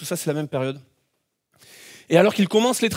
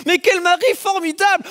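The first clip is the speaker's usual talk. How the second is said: much higher, bright admiring exclaim; delighted thought; 10 semitones higher than usual. Retold or imitated. Wife gushing about her husband in the air, delighted. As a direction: gush about your husband. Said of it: "tu te dis".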